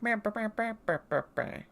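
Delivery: lightly mimicked animal gibberish; animal babble. Explaining that the animals aren't really talking, animal babble. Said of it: "they're animals. So they're going like".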